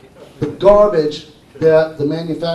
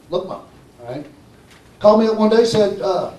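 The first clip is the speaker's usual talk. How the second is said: usual speaking voice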